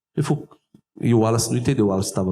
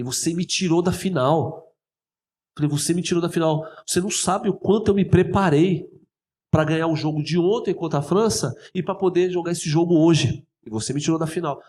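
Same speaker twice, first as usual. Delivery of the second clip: high, heated voice; telling the coach he was cut, voice high and heated; exalted, heated protest; 8 semitones higher than usual. Retold or imitated. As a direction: insist bitterly, voice pitched high. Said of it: "Eu tava muito exaltado".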